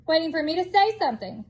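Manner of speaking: ordinary delivery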